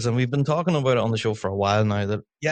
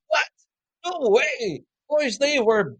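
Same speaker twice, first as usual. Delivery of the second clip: high-pitched retold indignation; squeaky-high mock protest; mock outraged disbelief; 13 semitones higher, slower than usual. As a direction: imitate incredulous onlookers, pitched way up. Retold outraged onlookers disagreeing with result.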